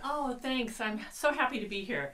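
distant and faint, off mic; turned away from mic. Real, not acted. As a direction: speak from away from the microphone. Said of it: "we sound like you're off mic there".

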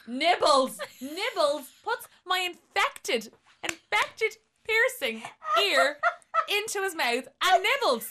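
high, loud, slow, laughing through it; laughing as she tells the ear story; laughing disbelief. Real, not acted. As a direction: relive the horror while cracking up. Retelling the ear nibbling.

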